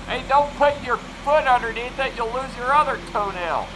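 high, sharp warning call. Real, not acted. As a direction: call out quickly to stop him. Warning someone near the bunker.

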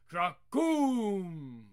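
booming thunder sound effect; thunder crack. Acted. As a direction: roll it out like thunder overhead. Thunder after incantation.

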